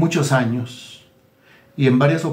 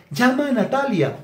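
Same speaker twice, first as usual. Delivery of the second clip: high, pious voice parroting an order from the Lord; mock divine order; 9 semitones higher than usual. Preacher mocking a flatterer who claims the Lord spoke, high pious voice. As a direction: sing out the holy instruction in a falsetto. Flatterer quoting what God told her.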